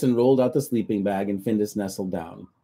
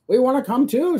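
much higher, louder hen squawk; 6 dB louder than usual. Acted. Hens demand to join the trip, loud high squawk. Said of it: "squawked the hens".

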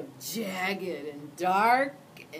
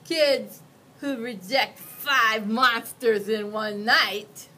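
louder, slow ominous warning; monster warning; 3 semitones higher, 11 dB louder, slower than usual. Acted. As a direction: spell out the threat. Monster warns child.

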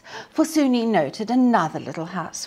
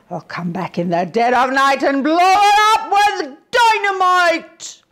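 wolf; 10 semitones higher, 7 dB louder than usual. A narrator's reading. Wolf threatens.

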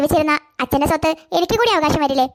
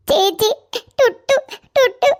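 high, tearful stammering of a name; crying; 4 semitones higher, much slower than usual. Acted. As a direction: choke out the name between sobs. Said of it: "കരയാതെ കാര്യം പറ". (കരഞ്ഞു വിക്കി വിക്കി)

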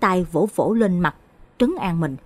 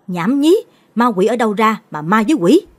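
sharp, loud, higher snap of dismissal; loud scolding; 3 semitones higher, 6 dB louder than usual. Acted. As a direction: bark it down at the boy. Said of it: "Chú Mạnh quát to". (người cha quát to gạt phăng chuyện ma)

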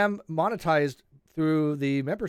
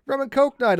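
high, slow, louder mimicry of her; mock her proud news in a raised voice; imitated proud announcement; 12 semitones higher, 5 dB louder than usual. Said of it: "She was, like, all proud".

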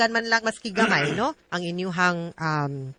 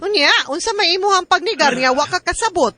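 mock scolding; 8 semitones higher, 5 dB louder than usual. Lawyer acting out a scolding.

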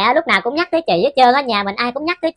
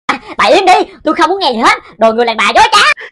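loud, furious yelling; possessed daughter screaming at her mother to shut up; angry shout; 4 semitones higher, 7 dB louder than usual. Acted. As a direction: shout her down, you want none of it. Possessed ghost lashes out at mother.

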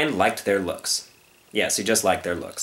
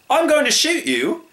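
high, blustering announcement; pompous storybook hunter; threatening hunter; 4 dB louder than usual. Acted. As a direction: declare the threat theatrically.

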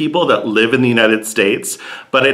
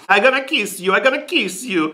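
mock Italian lover; 10 semitones higher than usual. Imitating Italian kisser.

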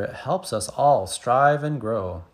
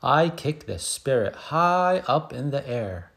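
ordinary delivery, nothing marked

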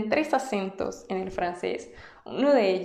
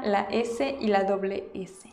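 ordinary delivery

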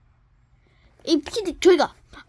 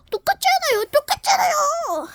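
much higher, whiny protest; shrill protest; 13 semitones higher than usual. Acted. Character protesting that the scores are identical. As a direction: whine it way up high.

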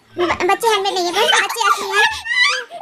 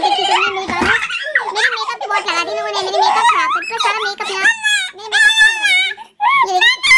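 mock whining; 10 semitones higher than usual. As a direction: whine in mock outrage. Mock complaint about ruined makeup.

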